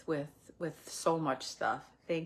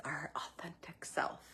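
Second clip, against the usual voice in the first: whispering; 10 dB quieter than usual. Real, not acted. Soft close to the point.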